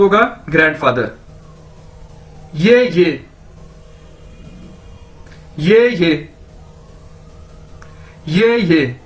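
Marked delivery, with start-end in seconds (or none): 2.5-6.3 ये ये, two level syllables, spaced out with long pauses; teacher drilling ये ये for grandfather; pronunciation demo. Shown as a demonstration. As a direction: say ये ये slowly for grandfather. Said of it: "इसको दो बार ये को बोलेंगे".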